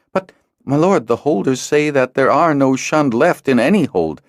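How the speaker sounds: protest. A narrator's reading. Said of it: "Larat protested".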